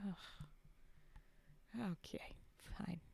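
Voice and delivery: soft, reluctant sarcastic grumble; mock-weary, quiet sarcasm. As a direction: give in grudgingly, under your voice. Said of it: "someone super sarcastic and just being like".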